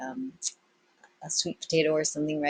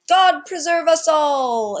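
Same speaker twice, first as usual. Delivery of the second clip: high, loud, slow cry; exclaiming; 11 semitones higher, 9 dB louder, slower than usual. Acted. Young pirate crying out in alarm, loud and slow. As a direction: throw up your hands in alarm. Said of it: "exclaimed Jimmy Puka Puka".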